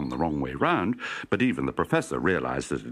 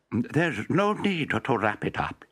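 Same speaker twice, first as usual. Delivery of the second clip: slow, higher contented professor; cheerful professor; 6 semitones higher, slower than usual. Acted. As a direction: draw it out happily, higher up. Professor refuses the wrapping.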